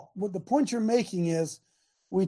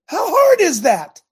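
emphatic challenge; 9 semitones higher, 9 dB louder, slower than usual. Real, not acted. Coach challenges his listeners.

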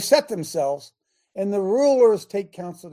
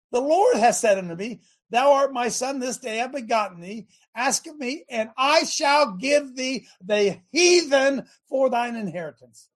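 raised, fervent scripture reading; preaching scripture; 5 semitones higher than usual. Acted. Pastor proclaims Psalm two to the congregation, preaching. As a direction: proclaim it like the pulpit's on fire.

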